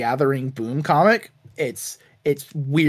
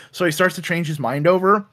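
ordinary delivery

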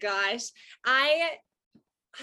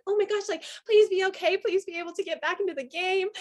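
anxious pleading; 7 semitones higher, 4 dB louder than usual. Retold or imitated. Retold pleading for player.

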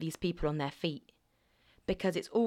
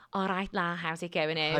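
playful Liverpool greeting; put-on Scouser; Scouse accent. Shown as a demonstration.

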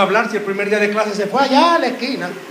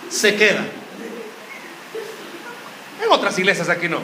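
ordinary talk, nothing marked

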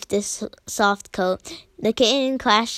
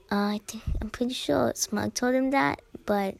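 quiet aside; 7 dB quieter than usual. Real, not acted. Aside comment while reading.

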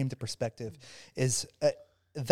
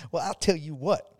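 lightly imitating the regional director's thick Oklahoma country accent; 5 semitones higher, 3 dB louder than usual. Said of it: "she has this very thick, very thick country accent".

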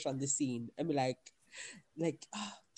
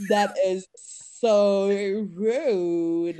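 loud, higher mock outrage; offended airhead, stretching the words; dumb blonde; 4 semitones higher, 9 dB louder, much slower than usual. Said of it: "dumb blondes".

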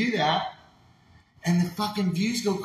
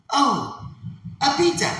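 much higher, louder mock surprise; NPC streamer; 9 semitones higher, 4 dB louder, slower than usual. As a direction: squeal it like a TikTok NPC reacting to a gift. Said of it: "And then you go".

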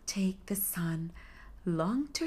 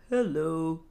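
put-on old husky voice; 3 semitones lower, 4 dB louder than usual. A narrator's reading. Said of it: "it called in an old husky voice".

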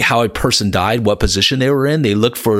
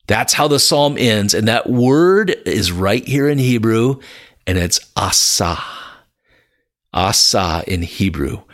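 ordinary delivery

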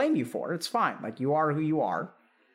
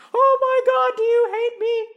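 very high, much louder hammed-up wail; mock wailing parent; 8 dB louder than usual. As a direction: parent flipping to the vulnerable side, shrieking. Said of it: "we just flip to the vulnerable side".

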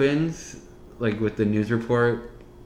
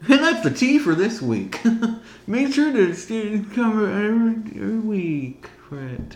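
show host sign-off; 9 semitones higher than usual. Pretend.

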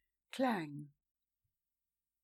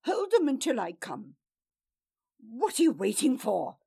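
louder, impatient orders; louder impatient shout; impatient command; 3 semitones higher, 7 dB louder than usual. Acted. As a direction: drive the guards on. Lord ordering hesitant guards.

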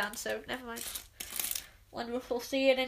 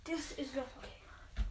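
quiet; 14 dB quieter, slower than usual. Pretend. Mock sulking.

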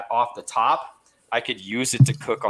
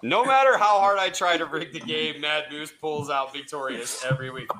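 game show host; 4 semitones higher, 5 dB louder than usual. Acted. Host hypes contestant.

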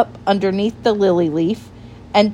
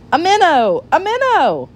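high-pitched, delighted cries; excited exclamation; 9 semitones higher than usual. A narrator's reading. Mister Jeremy feels a tugging on his line, excited. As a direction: cry out higher each time at the catch.

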